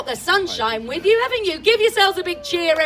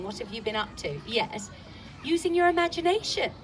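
ordinary delivery

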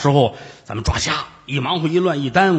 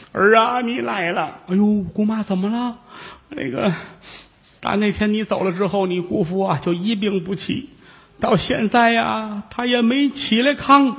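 put-on old aunt voice; 7 semitones higher than usual. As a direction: voice the weepy old aunt, pitched up. Old aunt voiced in pingshu tale.

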